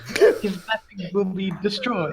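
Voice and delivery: slow villain declaration; gloating, drawn-out villain threat; unhinged villain. Pretend. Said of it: "I'm gonna do an evil laugh".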